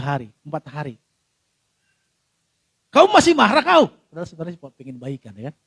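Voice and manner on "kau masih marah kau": high, loud challenge; mock lover's demand. Lover calling after days of silence, mock confronting. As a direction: ask it loudly, pitched up, acting put out.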